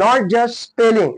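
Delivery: not just spelling, very slow with a hit on not; slow stressed teaching rule; slow emphatic rule. Shown as a demonstration. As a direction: drill the rule in slowly. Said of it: "त्याचा महत्वाचा आहे sound".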